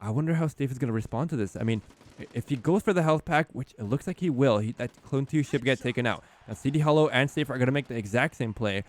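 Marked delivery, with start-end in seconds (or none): none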